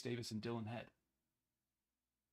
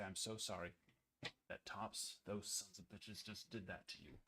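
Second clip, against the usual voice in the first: tender apology; 7 semitones lower, 16 dB quieter than usual. Pretend. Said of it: "And I would say".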